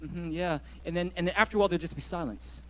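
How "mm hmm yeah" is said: absent murmur. Retold or imitated. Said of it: "I'll just be there going".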